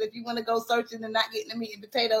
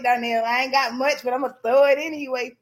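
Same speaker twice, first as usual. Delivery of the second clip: ordinary talk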